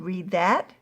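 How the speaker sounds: usual speaking voice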